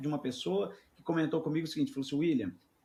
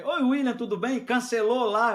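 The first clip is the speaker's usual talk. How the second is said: higher, louder cheery taunt; mocking high greeting; 8 semitones higher, 7 dB louder than usual. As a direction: sing it out like a smug director. Director greeting him mockingly.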